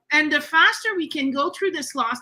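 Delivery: usual speaking voice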